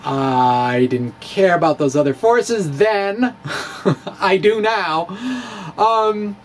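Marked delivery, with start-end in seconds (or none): 3.4-4.1 soft breathy chuckle. Real, not acted. Laughing at own remark.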